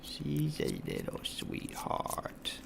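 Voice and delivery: slow, soft whispered fondness. Real, not acted. Streamer whispering to chat about his cat, slow. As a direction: whisper it so the cat keeps eating.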